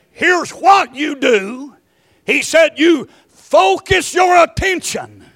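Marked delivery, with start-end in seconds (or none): 0.1-1.8 mock advice. Quoting what the psalmist doesn't say. 2.8-5.3 slow, high, loud command; proclaimed shout. Acted. Preacher quoting the psalmist to the church, shouting.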